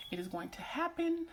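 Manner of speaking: high-pitched insistence. Real, not acted. Vlogger repeating what she has prayed for, high and insistent.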